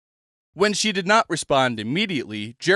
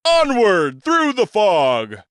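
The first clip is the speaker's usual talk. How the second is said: high, slow, hearty booming cheer; rally them with a grand old-showman shout; a hammy stage rallying cry; 6 semitones higher, 5 dB louder, slower than usual. Acted. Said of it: "Bostwick cried".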